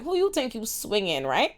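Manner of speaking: ordinary talk, nothing marked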